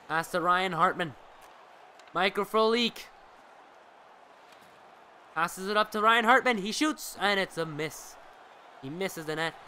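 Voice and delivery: brisk sportscaster call, rising on the shots; live play-by-play. Acted. Streamer calling the hockey game for his viewers, lively play-by-play.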